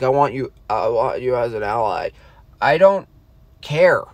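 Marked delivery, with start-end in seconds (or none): 0.0-2.1 wobbly voice. Retold or imitated. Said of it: "with a wobbly voice".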